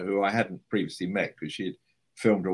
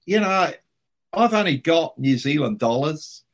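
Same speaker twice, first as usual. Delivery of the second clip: much higher, louder, sheepish mate; a friend admitting he only has the wrong cash, pitched up; imitating Richard; 8 semitones higher, 5 dB louder than usual. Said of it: "And he said".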